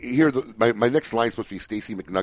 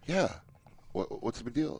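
soft, offhand shrug of a reply; unimpressed; 9 semitones higher, 12 dB quieter than usual. Retold or imitated. Imitating his friend's reaction to his own story, nonchalant. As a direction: play it down as nothing special. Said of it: "He's like".

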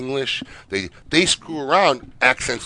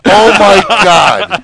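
slow, high, loud amazement; real astonishment; 7 semitones higher, 11 dB louder, much slower than usual. Real, not acted. Host reacting to guest's news, astonished cry. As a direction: draw it right out, loudly floored. Stunned at the Chuck Norris name.

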